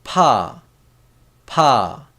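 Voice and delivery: pa, loud with a puff after the P; P sound demo. Shown as a demonstration. Teacher showing the P sound, demonstrating "pa" distinctly. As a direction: say "pa" as a separate model syllable, falling. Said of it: "P sound".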